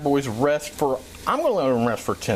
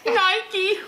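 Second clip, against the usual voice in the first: high sudden exclamation; excited shout. Real, not acted. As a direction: blurt it out high. Calling out.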